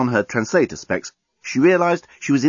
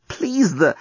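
higher-pitched mild protest; exclaiming; 8 semitones higher than usual. Acted. Lucy fending off the amorous alien, exclaiming. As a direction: plead for a stop, pitched up. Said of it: "exclaimed Lucy".

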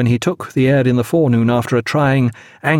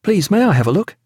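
higher, urgent request; eager, pressing plea in a raised pitch; 8 semitones higher, faster than usual. A narrator's reading. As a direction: beg with mild urgency. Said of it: "Then, urgently".

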